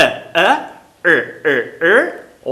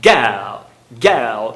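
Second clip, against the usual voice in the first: put-on girl with no R, British style; British accent girl without R; 8 semitones lower than usual. Shown as a demonstration. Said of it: "no sotaque britânico eles não pronunciam esse R".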